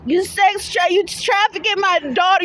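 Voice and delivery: ordinary talk, nothing marked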